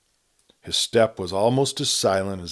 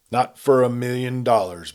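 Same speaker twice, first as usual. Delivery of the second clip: sudden, louder refusal; bursting in; 5 semitones higher, 5 dB louder than usual. A narrator's reading. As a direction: blurt it out firmly. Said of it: "burst in Hal Purvis".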